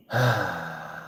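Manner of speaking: long, soft exhale; weary sigh before carrying on; heavy sigh. Real, not acted. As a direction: let out a slow breath. Pauses, doubting why he does this.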